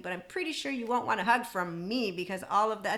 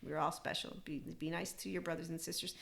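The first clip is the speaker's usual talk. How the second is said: low, soft reminder; gentle plea; 3 semitones lower, 9 dB quieter than usual. Real, not acted. Asking listeners to be nice to each other, gentle. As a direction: let it drop to a hushed, kindly aside.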